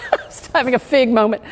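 laughing through the words; amused, tearing up with laughter; tearful laughter. Real, not acted. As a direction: say it quickly through a real laugh. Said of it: "tearing up about the figs".

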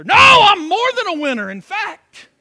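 defiant shout. Pretend. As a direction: fire it back at the accuser. Rebuts the taunt "you're a loser.

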